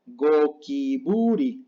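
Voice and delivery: "gokiburi" very slow, go-ki-bu-ri; slow syllable demo. Shown as a demonstration. Teacher models the last word, "gokiburi" syllable by syllable. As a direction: stretch each syllable of "gokiburi", hard g first. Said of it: "se lee".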